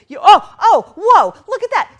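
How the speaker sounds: bursting out loud and high at the tally; excited. Real, not acted. Host sees the total jump past two hundred thousand, excited. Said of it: "Sorry, I just got excited".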